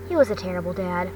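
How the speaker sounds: plain reading voice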